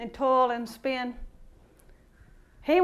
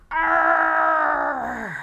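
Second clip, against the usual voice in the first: mock lion roar; hammed-up roaring lion. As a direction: let out a shouted roar. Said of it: "He's in there going".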